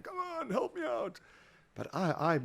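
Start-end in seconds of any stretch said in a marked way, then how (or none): 0.0-1.2 high, slow, pleading mock voice; mock plea to friends. Shaking his fist at friends, mock high slow plea. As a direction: whine it up high like a fed-up beggar. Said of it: "shaking my fist at close friends going".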